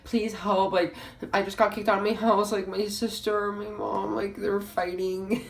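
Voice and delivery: high, wailing mock sob; bawling. Friend calls, kicked out of house, mock bawling. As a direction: imitate a friend sobbing down the phone. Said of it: "she's bawling, and she's like".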